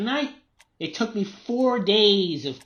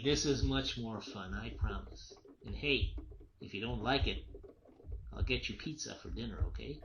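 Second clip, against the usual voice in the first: soft coaxing; 5 semitones lower, 10 dB quieter than usual. Acted. Father coaxing his daughter into the shed, soft and quiet. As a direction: lure her gently with promises.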